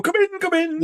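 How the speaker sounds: very high, hammy quoted voice; Peter Vincent impression. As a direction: squeak it out like a ham actor. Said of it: "he go".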